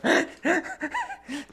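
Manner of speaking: high-pitched burst of laughter. Real, not acted. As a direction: crack up high. Laughing at dwarf image.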